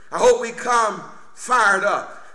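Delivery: ordinary delivery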